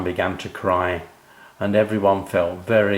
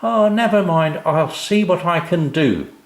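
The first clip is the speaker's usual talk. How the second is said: high, louder kindly handyman; kind reassurance; 8 semitones higher, 5 dB louder than usual. Acted. O'Grady comforts Sam about his shed, kind and high. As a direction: reassure the sad boy gently. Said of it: "said kind Mister O'Grady".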